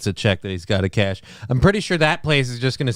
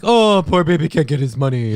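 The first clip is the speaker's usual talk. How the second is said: mocking taunt; 3 semitones higher, 3 dB louder than usual. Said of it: "just ripping on him, like".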